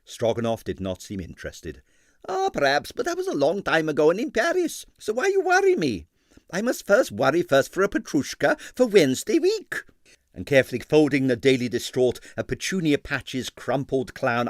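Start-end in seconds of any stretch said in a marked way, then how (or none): 2.3-9.8 put-on high, heavily accented excitable impresario; Stroganoff's Russian accent. A narrator's reading. Ballet impresario to the detective, in a Russian accent.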